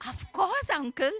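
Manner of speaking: fake recognition. Niece pretends to remember her uncle, falsely keen. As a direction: pipe up with put-on certainty.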